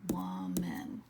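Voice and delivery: Woman split into two syllables. Shown as a demonstration. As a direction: tap out Woman in two beats. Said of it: "Woman has two syllables".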